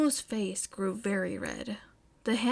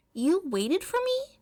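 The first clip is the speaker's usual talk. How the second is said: high, louder May voice, surprised; 8 semitones higher, 4 dB louder than usual. Acted. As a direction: she can't believe Momo waited. May's surprised question.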